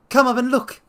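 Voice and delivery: put-on old patient yelling at the doctor; yelled. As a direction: scream the order in panic. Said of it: "he yelled".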